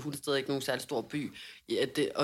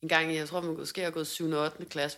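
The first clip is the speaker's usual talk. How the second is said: ordinary delivery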